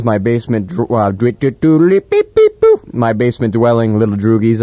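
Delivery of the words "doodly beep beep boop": beep boop noises. Pretend. Robot noise stammer.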